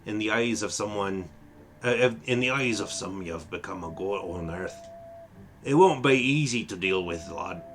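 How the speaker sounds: a light Irish lilt, fatherly and grave; Irish accent. Acted. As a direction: speak as an Irish elder blessing the lad. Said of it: "how did I slip into an Irish accent".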